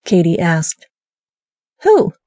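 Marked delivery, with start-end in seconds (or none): 1.8-2.1 high, innocent "who?"; feigned surprise. Acted. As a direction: play dumb, pitch jumps way up. Feigned ignorance, asked about boss.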